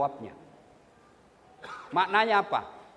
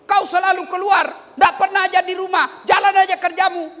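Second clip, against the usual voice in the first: loud mock scolding, higher; nagging wife; 7 semitones higher, 8 dB louder than usual. Wife yelling husband is never home, loud mock scold. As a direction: shout the complaint at the husband. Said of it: "binimu marah macam itu".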